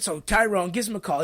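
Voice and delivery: usual speaking voice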